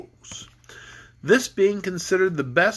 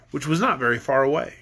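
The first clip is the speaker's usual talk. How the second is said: usual speaking voice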